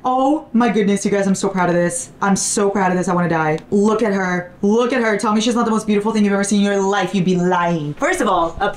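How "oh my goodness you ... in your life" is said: ordinary delivery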